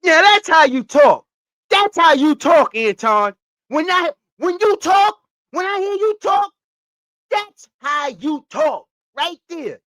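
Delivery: loud, high cheering-on shout; hyped approval. Acted. Listener cheering on Anton's way of talking, hyped high shout. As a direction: holler it high, stretching the words out. Hyped echo of Bad Boys line.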